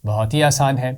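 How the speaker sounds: normal talking voice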